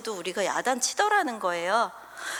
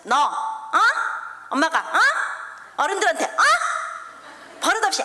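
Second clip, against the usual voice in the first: 점점 높고 크게 아이를 다그침; 어른들 앞에서 아이를 꾸짖듯 외쳐; 소리치며 혼내기; 7 semitones higher, 3 dB louder than usual. Retold or imitated. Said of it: "막 소리 지르면서 혼내시는". (시댁 어른들 앞에서 아이 꾸짖는 엄마, 점점 커지는 호통)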